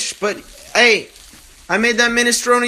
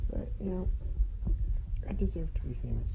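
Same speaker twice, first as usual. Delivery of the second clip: softer, whispered aside; whispering; 2 semitones lower, 15 dB quieter, faster than usual. Real, not acted. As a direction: whisper it to yourself. Confides muttering at end.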